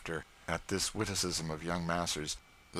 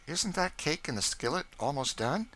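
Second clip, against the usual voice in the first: put-on high, eager boy's voice; young George voice; 9 semitones higher than usual. A narrator's reading. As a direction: beg hungrily for the cake.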